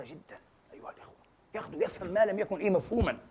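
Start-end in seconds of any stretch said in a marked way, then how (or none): none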